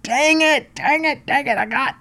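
high, squeaky mock frustration; comic high-pitched repeated dang it; frustrated dang it. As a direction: groan it like a sore loser. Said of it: "he was a little scared when I said".

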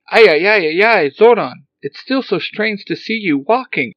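high, loud frantic robot cry; excited robot. Acted. Alpha startled to see Zordon walking, high excited robot. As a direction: fret like a flustered little robot.

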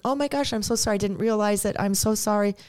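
high, sweet, apologetic friend; sweet apology. Retold or imitated. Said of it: "They were so sweet and so kind".

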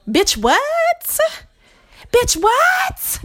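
high incredulous double take; incredulous bitch what. Real, not acted. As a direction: fire it back, stunned and outraged. Incredulous retort to commenters.

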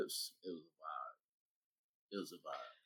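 low, slow, soft and dreamy; stoned. Retold or imitated. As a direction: sink into the memory.

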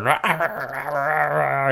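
drawn-out, rolling monster growl; monster roar. Acted. Co-host imitating monsters smashing through the boardwalk, drawn-out roar. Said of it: "monsters just smashing through everywhere, going".

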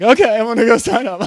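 high, loud, tearful crying through the words; sobbing okay. Retold or imitated. Agreeing to sign up while still sobbing to Sean. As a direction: blurt it out mid-sob. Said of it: "just still sobbing".

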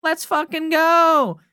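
mild mock yell, stretched out; imitating a screaming fan. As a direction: belt it like a fan in the stands. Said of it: "[name] screaming at the top of her lungs".

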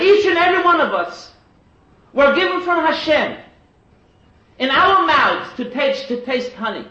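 loud, high, slow impassioned preaching; preaching at full force. Acted. Rabbi urges parents to teach Torah, preaching at full force. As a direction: thunder it out to the congregation.

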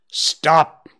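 loud commanding call. A narrator's reading. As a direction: call him back sharply. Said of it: "he heard the elder call".

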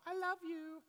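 put-on squeaky teen "I love you"; mock middle schooler. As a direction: tease a casual kid's crush voice. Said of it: "middle schoolers use love all the time".

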